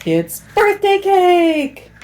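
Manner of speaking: high, loud, slow excited announcement; excited reveal. Real, not acted. Big reveal to child.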